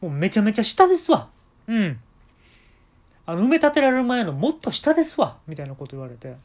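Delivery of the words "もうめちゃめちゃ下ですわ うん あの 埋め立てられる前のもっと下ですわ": louder, higher thick Kansai dialect; exaggerated Kansai dialect dentist, pushing. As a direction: pile on the heavy Kansai dialect, insisting. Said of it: "すごい関西弁になって".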